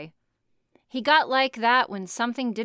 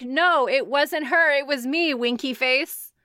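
mock kid voice; 4 semitones higher, 5 dB louder, slower than usual. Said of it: "We'd be like".

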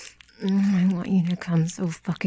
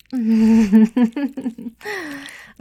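loud, higher rippling chuckle; teasing laugh; 4 semitones higher, 7 dB louder than usual. Acted. Amused by the partner's reaction.

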